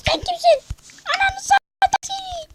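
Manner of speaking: mock taunt. Taunting enemy player.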